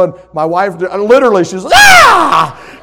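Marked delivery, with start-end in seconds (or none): 1.7-2.8 high, loud startled scream; acted scream. Wife spooked by the officer at her window, acted scream. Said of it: "and screamed".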